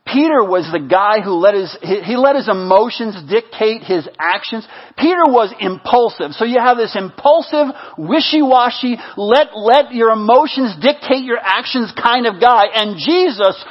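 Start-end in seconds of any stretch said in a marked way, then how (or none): none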